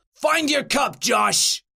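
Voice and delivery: high parting shout; urgent high call. Acted. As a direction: holler it to a friend as you are dragged off. Carried-off friend calls out.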